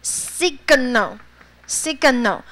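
"Signal" spelled out slowly and clearly; teacher drilling the word; very slow model reading. Shown as a demonstration. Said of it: "信号那个字". (慢速示范念 Signal)

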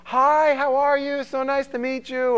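high, loud, gushing polite greeting; greeting the parents. Acted. You meet the person's parents, high gushing greeting. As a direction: put on an over-eager high hello. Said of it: "you meet the person's parents, and you're like".

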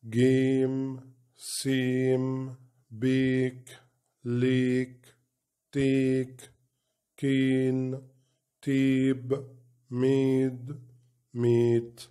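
words like دي and وي with the long "ay" sound; long A drill. Shown as a demonstration. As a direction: read each long A word carefully. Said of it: "تبقى". (قراءة كلمات A الطويلة)

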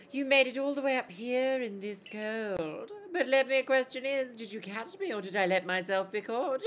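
high, coy voice ending in a giggle; tease, then burst into a loud high laugh; playful child Sabi. Acted.

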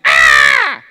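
sudden loud "Ah!" scream; demonstrated scream. Shown as a demonstration. Preacher showing what a sigh is not, mock scream. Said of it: "A sigh isn't a scream".

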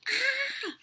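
sharp high yelp of fright; high scream. A narrator's reading. Sherman as the flying machine rockets off, high scream. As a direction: shriek as the machine shoots off. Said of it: "I screamed".

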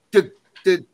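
ordinary delivery, nothing marked

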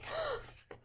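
sharp high gasp. Pretend. Reacting to the chaos for the child, a mock gasp. Dramatic story moment.

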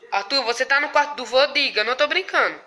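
ordinary delivery

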